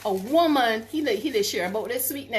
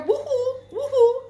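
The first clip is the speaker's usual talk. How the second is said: high mock call; calling woohoo; 13 semitones higher, 4 dB louder than usual. Vlogger imitating the woman calling in the street. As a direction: woohoo called out high into the night. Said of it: "she hear".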